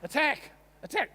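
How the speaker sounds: high, loud "attack" at the dog; shouted command. Real, not acted. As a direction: egg the dog on, yelling. Urging dog at the other.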